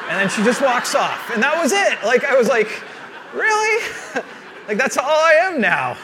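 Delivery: high, loud comic disbelief; mock indignation. Dad snubbed by his daughter, loud mock outrage. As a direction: protest at full volume, voice leaping up.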